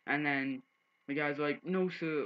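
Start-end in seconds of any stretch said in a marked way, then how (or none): 1.6-2.2 higher-pitched meek imitation; mock polite. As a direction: mimic someone backing down with exaggerated politeness. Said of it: "acting all polite".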